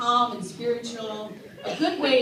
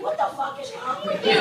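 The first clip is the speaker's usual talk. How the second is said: exasperated inner voice. Retold or imitated. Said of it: "The inside of me was like".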